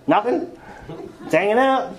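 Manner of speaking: high-pitched, too-casual answer; put-on airy breeziness; fake nonchalance. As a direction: cover desperation with mock innocence. Acts himself pretending nothing is wrong.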